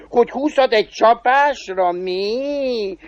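high devil voice ending in cackles; chuckling devil. Acted. Devil checking the cobbler's twenty-at-one-blow claim, high and amused. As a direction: quote the boast high-voiced, then cackle.

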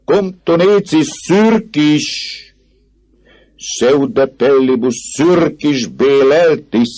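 high, slow, drawn-out proclamation; mock Latin jeer. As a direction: chant it out pompously in mockery. Student jeers in mock Latin.

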